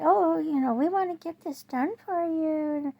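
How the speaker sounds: high, loud mock-sweet contractor voice; sarcastic contractor impression. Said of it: "they say".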